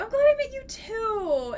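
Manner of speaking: high delighted squeal; excited repeat. Real, not acted. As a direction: say it again, beaming. Repeats thanks louder, higher.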